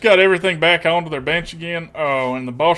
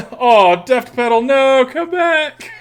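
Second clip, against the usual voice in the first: playful plea; 7 semitones higher, 7 dB louder, slower than usual. Real, not acted. Pleading to departing viewer.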